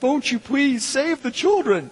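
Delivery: high, slow, weepy charity appeal; mock tearful plea. Preacher mimicking Sally Struthers crying on TV, high and slow. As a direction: plead for the children as if crying. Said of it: "she'd be crying".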